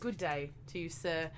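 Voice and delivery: put-on British accent drifting Australian; a shaky British accent; attempted British accent. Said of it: "that's getting Australian now".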